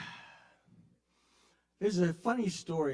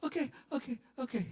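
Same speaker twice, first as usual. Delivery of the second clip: higher, quick frightened okays; scared okay; 9 semitones higher, 5 dB quieter, faster than usual. Retold or imitated. Threatened driver giving in, high frightened okays. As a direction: give in fast and scared. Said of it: "break your fucking arm".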